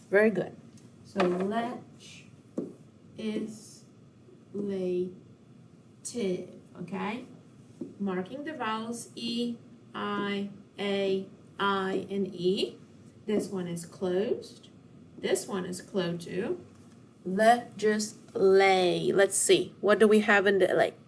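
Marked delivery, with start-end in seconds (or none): 1.0-5.1 "legislative" softly, with long gaps between syllables; split into syllables. Shown as a demonstration. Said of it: "split the word legislative into syllables". 17.3-19.1 "Legislate" drawn out slowly in separate syllables; split into syllables. Shown as a demonstration. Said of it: "split the word legislative into syllables".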